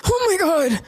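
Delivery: high, startled mock gasp; a cinemagoer caught off guard; shocked gasp. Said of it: "than be like".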